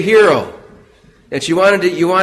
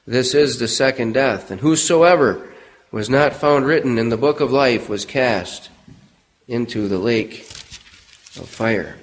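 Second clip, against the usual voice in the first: solemn scripture reading; 6 semitones lower than usual. Acted. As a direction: read it like a verdict from the pulpit.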